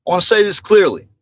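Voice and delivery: normal talking voice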